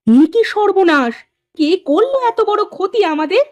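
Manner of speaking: high-pitched horrified exclamation; theatrical shock; shocked dismay. Acted. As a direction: cry out in alarm at the loss. Wife discovers the ruined goods.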